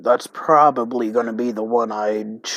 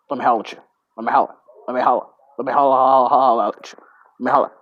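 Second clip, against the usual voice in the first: imitating holla guy; 5 dB louder, much faster than usual. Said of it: "a dude who could say holla super fast".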